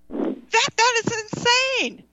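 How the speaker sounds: high, slow pitch jump on insane; outraged exclaim. Real, not acted. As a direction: stretch it out in disbelief. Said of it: "I was so upset".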